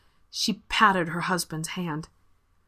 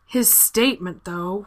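plain reading voice

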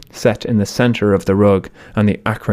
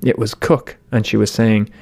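plain reading voice

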